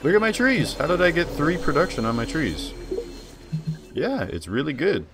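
high, loud excited gushing; thrilled, bragging about his trees; hyped. Real, not acted. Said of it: "That's why I was hyped".

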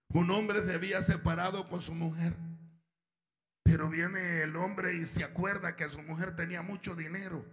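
normal talking voice

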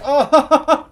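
loud, high burst of laughter; big high cackle; gleeful laugh. Real, not acted. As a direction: crack up loudly at the chaos. Laughing as enemies fight.

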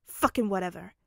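loud, irritated brush-off; irritated dismissal. Acted. Bakugo brushing off Midoriya in a quarrel, irritated. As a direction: throw it away with annoyance.

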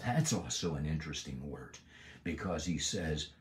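ordinary delivery

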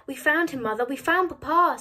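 put-on high, loud, excited boy; eager announcement. A narrator's reading. Young boy telling mother they found father, eager announcement. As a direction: burst out with the big news.

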